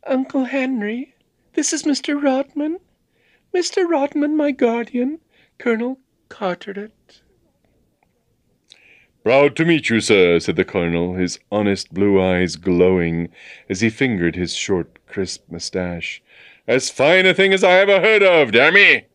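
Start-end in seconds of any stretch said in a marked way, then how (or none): 0.1-7.2 put-on very high, light girl voice; high young lady making introductions; girl's voice. A narrator's reading. Girl introducing guardian in story. 9.2-10.4 loud, lightly voiced colonel greeting; a touch of the bluff colonel; hearty colonel. A narrator's reading. 16.7-19.1 loud, high-rising hearty exclamation; blustering military admiration; bluff colonel. A narrator's reading. Bluff colonel praising the rescue.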